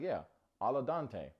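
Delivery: slow "al dente" as "ahl-uh DAHN-tay"; mock al dente. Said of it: "Now look, you guys have been watching".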